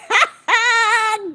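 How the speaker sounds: high, bursting laugh; delighted laugh. Real, not acted. Overwhelmed by Alex's bunch of gifts, delighted laugh.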